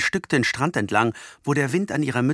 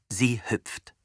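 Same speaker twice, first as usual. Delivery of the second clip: ordinary delivery, nothing marked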